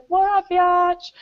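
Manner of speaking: slow heckle. Pretend. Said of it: "we're gonna be like".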